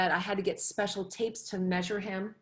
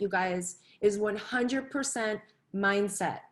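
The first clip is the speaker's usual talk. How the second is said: ordinary delivery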